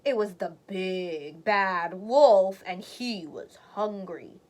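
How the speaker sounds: slow, ominous reveal of the wolf; ominous narration. A narrator's reading. Narrator slows down to reveal the hungry wolf, ominously. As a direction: linger on it like a scary secret.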